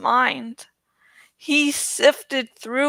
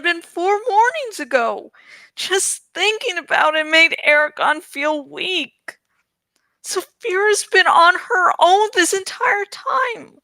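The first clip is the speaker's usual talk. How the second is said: put-on alarmed young Eragon; 7 semitones higher than usual. A narrator's reading. Eragon hears he slept two days, higher alarmed youth. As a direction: voice a startled young man, higher.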